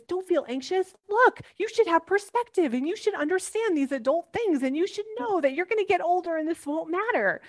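put-on high, preachy parent lecturing a child; hammed-up parent brushing off feelings; mock lecturing parent.

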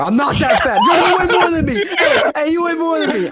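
high, loud, laughing defense; laughing protest. Real, not acted. Laughing back at the friend who called him fat.

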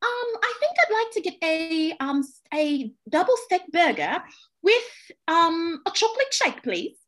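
put-on British accent for ordering; mock British customer. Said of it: "we are using these British accents".

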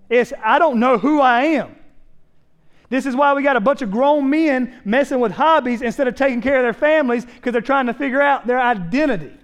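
high, loud, driving sermon delivery; impassioned preaching. Real, not acted. Preaches at full force.

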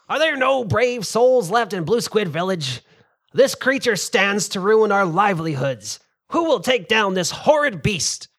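loud, high rallying cry; rousing village elder. Acted. Fishmongi pleading with the festival crowd, loud rallying call.

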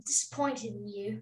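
softly attempted British accent; quiet, playful go at a British accent; [name]'s British accent. Kid imitating an accent for parent on request, soft British accent. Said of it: "that was a little more British".

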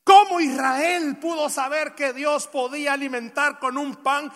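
fervent pulpit questioning; rhetorical preaching. Real, not acted. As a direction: challenge the congregation question by question.

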